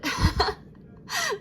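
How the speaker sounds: high, self-mocking laugh; real laugh. Real, not acted. Vlogger laughs after judging her accent not good, real high laugh. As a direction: laugh at your own attempt.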